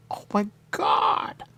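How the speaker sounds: high, slow, crying oh my God; mock wail. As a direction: whine it out in despair. Frustrated retold exchange.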